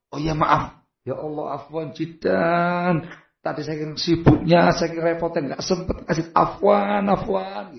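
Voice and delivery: quiet, slow sheepish sorry; soft apology. Retold or imitated. Husband softly apologizing to his wife, quiet and slow.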